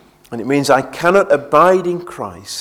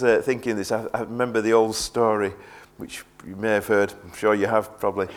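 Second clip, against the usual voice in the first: ordinary talk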